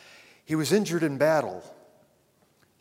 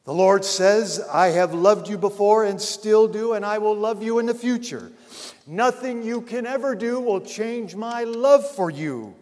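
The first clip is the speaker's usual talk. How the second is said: louder, higher preaching swell; preaching at full force; 8 semitones higher, 8 dB louder than usual. Acted. Preacher to congregation about God's love, fervent and louder. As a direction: lift the congregation with fervor.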